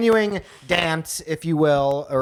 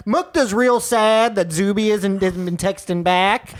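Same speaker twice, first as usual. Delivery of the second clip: slow Southern drawl, lightly done; a hint of a down south matchmaker; Southern accent; 5 semitones higher, slower than usual. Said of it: "down south ass matchmaker".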